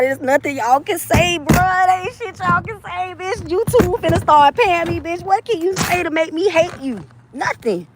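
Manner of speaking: high, mocking sing-song taunt; taunting haters. Real, not acted. Streamer taunting her haters, high and mocking.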